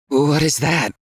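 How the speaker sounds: weak, winded question; gasping a little after a sprint; out of breath. A narrator's reading. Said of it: "how weak and out of breath he already sounded".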